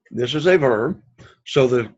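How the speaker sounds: ordinary delivery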